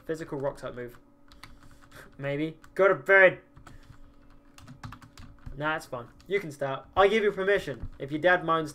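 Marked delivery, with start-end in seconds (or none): 2.7-3.4 loud, abrupt mock telling-off; mock-stern order. Streamer ordering a viewer to bed, mock-stern shout. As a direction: shout it like a bossy parent, playfully.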